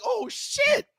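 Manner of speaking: put-on shocked kid. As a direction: blurt it like a scared kid. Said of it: "I was like".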